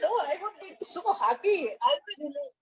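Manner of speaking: high-pitched, gushing acceptance; delighted reply. Retold or imitated. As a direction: act out someone happily saying yes. Acting out the auntie's delighted reply.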